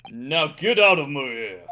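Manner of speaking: loud, contemptuous jailer; angry governor. Acted. Governor ordering the suspicious Easterners out, loud anger. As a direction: drive them out with scorn.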